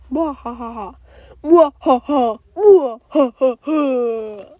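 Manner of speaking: hammed-up villain mwahaha, some drawn out slow; evil laugh. Toy villain gloating over his plan with evil laugh. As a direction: cackle like a cartoon supervillain.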